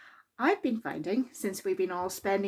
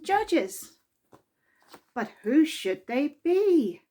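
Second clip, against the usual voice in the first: lively, expressive read-aloud voice; children's story reading; 4 semitones higher than usual. A narrator's reading. Narrator reading a Mister Men story to children, lively and expressive. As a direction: read it to a room of small children. Reads picture book aloud.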